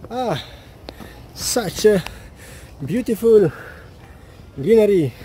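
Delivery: panting up the steps; breathless between phrases; out of breath. Real, not acted. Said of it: "huffing and puffing".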